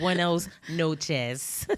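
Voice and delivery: drawn-out Spanish greeting; very slow. Pretend. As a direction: stretch every syllable out.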